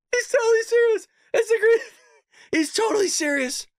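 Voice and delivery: high, tearful disbelief through laughter; real laughing fit, voice pushed up; helpless laughing. Real, not acted. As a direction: insist on it while cracking up. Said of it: "Probably beat red right now".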